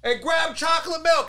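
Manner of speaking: very high mock screaming; high, strained imitation of a screamer; retold scream. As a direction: act out a screamed scolding. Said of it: "he's screaming at them".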